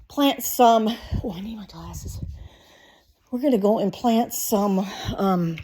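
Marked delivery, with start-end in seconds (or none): none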